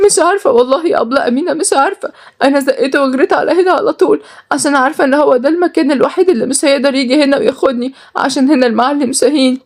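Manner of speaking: high, agitated woman's voice; distressed runaway. Acted. Runaway telling of forced marriage, distressed.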